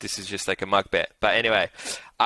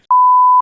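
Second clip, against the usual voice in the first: loud laugh; 14 dB louder than usual. Real, not acted. Bursting out laughing while retelling the big win, loud and high. Giddy over winning bet.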